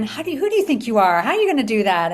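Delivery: the doubting inner voice mocking her, quick and sharp; inner critic. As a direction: sneer like your own doubt. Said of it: "And then".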